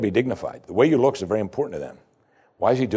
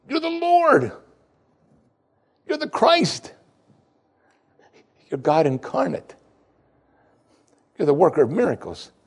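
high, pleading disbelief; a stunned disciple refusing his master; incredulous Peter; 9 semitones higher than usual. Acted. Peter protesting Jesus washing his feet, high disbelief.

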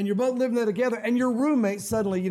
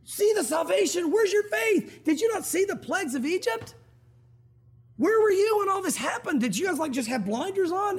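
much higher, exasperated scolding; rebuking Moses; 10 semitones higher than usual. Retold or imitated. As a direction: chide them for their lack of faith. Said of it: "He gets their attention".

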